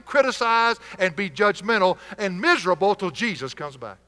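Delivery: loud, high, driving sermon climax; full-force preaching. Acted. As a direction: hammer the point home to the congregation.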